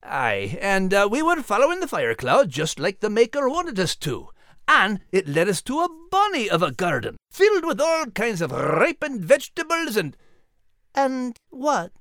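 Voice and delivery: lightly Scottish storybook hero, a bit flustered; a hint of Scots as he fumbles for words; light Scottish Max. Max handing narration to the announcer, light Scottish voice. Max's Scottish-flavoured lines.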